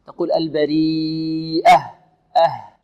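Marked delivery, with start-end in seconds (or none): 0.3-1.8 البريئة with a long ee and an audibly breathed final h; clear final haa. Shown as a demonstration. Teacher shows students the final letter, clear h. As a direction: draw out البريئة, then release the h clearly. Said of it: "نوضحها".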